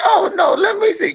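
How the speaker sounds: very high, put-on falsetto; mock baby-admirer falsetto; imitating cooing stranger. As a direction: insist in a high, gushing mock voice. Insistent cooing stranger, retold.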